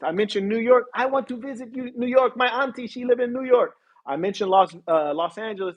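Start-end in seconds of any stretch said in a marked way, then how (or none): 0.9-3.7 high, eager put-on voice; mock excitement. People hearing New York mentioned, imitated excitement. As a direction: imitate a starry-eyed fan, pitched way up.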